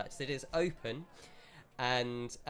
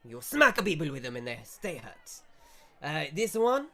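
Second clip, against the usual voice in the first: louder, higher, fast mock Italian shopkeeper; shopkeeper accent; 5 semitones higher, 5 dB louder than usual. Shopkeeper brushes off customer, louder fast mock Italian accent.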